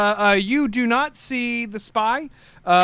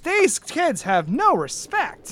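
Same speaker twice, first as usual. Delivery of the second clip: put-on high, indignant old man ranting; fired-up old knight; 9 semitones higher, much slower than usual. Old knight Cecil fumes to coworkers about the stolen cake, high and indignant. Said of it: "It's like".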